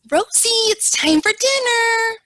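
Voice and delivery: high, loud condescending sing-song; patronizing caregiver voice to a resident; talking down. Retold or imitated. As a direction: talk down to her like a child. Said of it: "the lady kinda spoke down to her".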